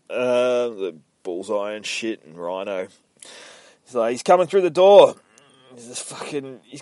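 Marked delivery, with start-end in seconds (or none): 4.1-5.2 high, loud panicked cry; hammed-up panic; panicked warning. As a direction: yell the warning like they're trapped. Trapped villains panic.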